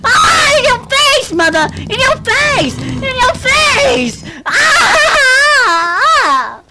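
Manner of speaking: high, loud victory yells; In your face, shrieked in triumph; triumphant screaming. Real, not acted. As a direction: gloat and scream as you win. Winning the round, gloating.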